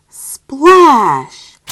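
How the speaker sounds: loud punched splash; splashy sound word; big splash. Acted. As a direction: make the splash land.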